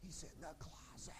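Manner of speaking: very soft hushed whisper, retold; secretive hint. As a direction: drop it to a sneaky hush from hiding. Hidden dad gives a hint.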